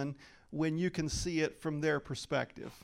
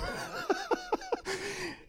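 real laugh, much higher; 4 dB quieter than usual. Real, not acted. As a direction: giggle high at yourself. Laughing at own answer.

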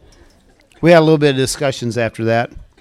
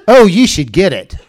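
eager encouragement; 6 semitones higher, 8 dB louder than usual. Retold or imitated. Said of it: "And she goes".

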